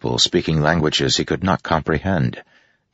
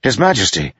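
higher, louder startled cry; 7 semitones higher, 3 dB louder, faster than usual. A narrator's reading. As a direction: jump up and call out. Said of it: "Kaladin cried".

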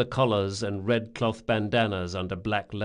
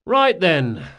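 raised, brisk wrap-up; tired leader voice; 7 semitones higher, 8 dB louder than usual. Acted. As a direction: close the meeting briskly. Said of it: "Locke yawned".

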